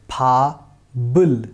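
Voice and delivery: फाबल split as pha-bul, p turned to b; carry-over pronunciation. Shown as a demonstration. Teacher showing how the whole word will be pronounced, carry-over pronunciation. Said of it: "इसका pronunciation हो जाएगा".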